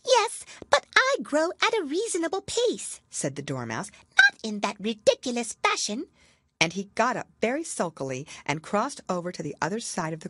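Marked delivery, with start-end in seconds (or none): 0.0-3.0 high, huffy little Dormouse. Acted. Dormouse voiced in story. 4.2-6.1 high, huffy little Dormouse. Acted. Dormouse voiced in story.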